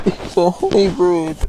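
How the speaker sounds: loud, high, drawn-out cry; sudden high yell at the game; real startled outburst. Real, not acted. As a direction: blurt it out loud, stretching the words. Sudden reaction to enemy appearing.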